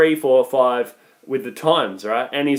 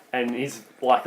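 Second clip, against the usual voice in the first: normal talking voice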